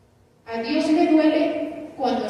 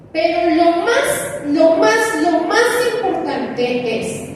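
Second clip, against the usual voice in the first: emphatic build; 6 semitones higher, 6 dB louder than usual. Real, not acted. Preacher stressing key point.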